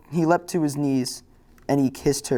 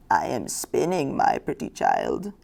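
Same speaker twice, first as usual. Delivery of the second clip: put-on old woman voice; slower than usual. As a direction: creak it out like an ancient spinner. Old deaf woman spinning answers.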